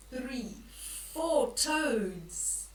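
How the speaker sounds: ordinary delivery, nothing marked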